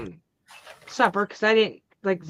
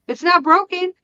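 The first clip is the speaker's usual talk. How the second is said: higher, louder mock-innocent kid voice; son's innocent reply; 9 semitones higher, 6 dB louder than usual. Son holds up the thrown phone, mock-innocent kid voice. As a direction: play the kid with a bright high excuse. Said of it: "he picks it up and goes".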